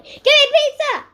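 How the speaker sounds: put-on spoiled child screaming for pizza; yelling. A narrator's reading. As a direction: shriek a spoiled demand. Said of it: "yelled Ivy".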